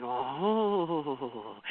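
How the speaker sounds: long, soft rising-and-falling "ooh"; soft musical sound-making; wordless noise. Shown as a demonstration. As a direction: let out a drawn-out, quiet wordless oooh. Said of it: "kind of make the noises".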